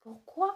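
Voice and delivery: Pourquoi high and loud, rising on quoi; reading demonstration. Shown as a demonstration. Teacher showing class how to read it, rising Pourquoi. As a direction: read Pourquoi as pour plus kwa, lifted at the end. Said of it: "this is how we read it".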